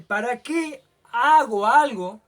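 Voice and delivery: pregunta interior más fuerte y lenta; preguntándose a sí mismo. Retold or imitated. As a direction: como quien se despierta y se pregunta en voz alta. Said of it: "me pregunto a mí mismo". (voz de pregunta interior, lenta y fuerte)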